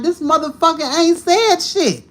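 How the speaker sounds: hearing the amens, she explodes in disbelief; exasperated outburst. Retold or imitated. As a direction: blurt it out, fed up with the preacher. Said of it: "I'm looking like".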